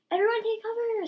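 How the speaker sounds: high, loud warning scream; urgent high-pitched cry. A narrator's reading. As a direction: shriek for everyone to hide. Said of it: "screamed Caddy".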